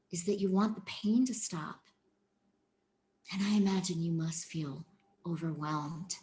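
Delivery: soft, steady, tender reply; softly reassuring therapist voice; gentle demo. Shown as a demonstration. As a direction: speak gently to a hurting child. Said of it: "it would sound like this".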